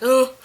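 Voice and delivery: loud frustrated "ugh"; exasperated groan. Retold or imitated. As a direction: let out a short, loud groan of annoyance. Retells her one frustrating moment.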